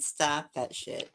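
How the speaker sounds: normal talking voice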